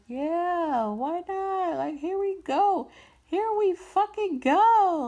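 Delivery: loud, high, excited whoop; hyped-up cheer. Acted. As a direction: build to a drawn-out shout of hype. Acted hyped-up vibing with friends.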